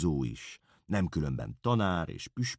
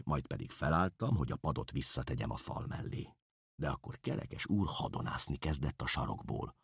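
ordinary delivery, nothing marked

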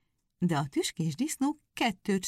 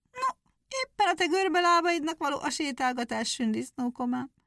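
high, mocking hare, much higher; 9 semitones higher than usual. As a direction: tease him about his crooked legs. Hare mocks hedgehog's legs.

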